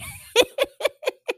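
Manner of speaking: sudden high cackle at her own story; high burst of laughter. Real, not acted. Recapping the show, she cracks up laughing.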